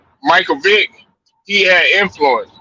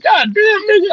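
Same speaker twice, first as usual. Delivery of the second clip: shocked exclaim. Retold or imitated. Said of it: "I'll be like".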